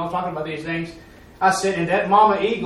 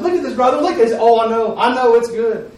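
higher, louder excited exclaiming; acted eagerness, voice raised; retold amazement; 6 semitones higher, 8 dB louder than usual. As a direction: lightly imitate someone thrilled, showing a friend. Amazed congregation member exclaiming, retold.

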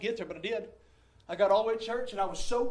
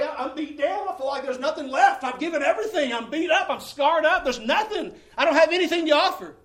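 raised, louder cry of despair, breaking into laughs; anguished cry; 6 semitones higher, 9 dB louder than usual. Retold or imitated. Preacher voicing his old despair to the church, raised lament. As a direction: pour out the weariness as a loud lament.

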